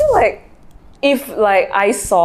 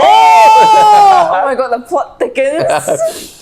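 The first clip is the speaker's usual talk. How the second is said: slow, loud drawn-out gasp; dramatic excitement; 6 semitones higher, 7 dB louder, much slower than usual. Real, not acted. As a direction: savour the scandal slowly.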